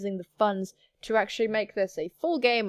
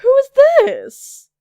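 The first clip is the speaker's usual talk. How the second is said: high, loud, drawn-out outburst; slow shouted challenge, pitched way up; indignant demand; 13 semitones higher, 13 dB louder, much slower than usual. Acted. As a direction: demand it at full volume, outraged. Boss confronts a stranger's presence.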